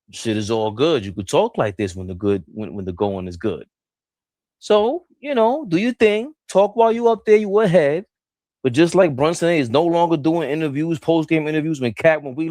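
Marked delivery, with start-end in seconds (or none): 4.6-8.1 mocking. Real, not acted. Mock encouraging the player.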